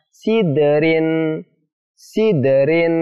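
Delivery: "Sidrin" with a bounced d, like si-de-rin; qalqalah bounce. Shown as a demonstration. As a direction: release the d of "Sidrin" in a short bounce. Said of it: "dipantulkan. Jadi cara bacanya seperti apa?".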